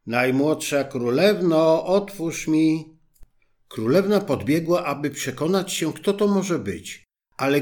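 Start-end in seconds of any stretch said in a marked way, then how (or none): 0.1-3.0 frog calling at door. A narrator's reading. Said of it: "zastukała i woła".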